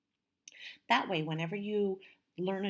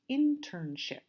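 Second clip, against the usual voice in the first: internship with IN higher and stronger; first-syllable stress; 5 semitones higher, 3 dB louder, slower than usual. Shown as a demonstration. Teacher introduces the last word, internship stressed on IN. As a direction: lean on IN at the start of internship. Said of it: "the stress is on the first syllable".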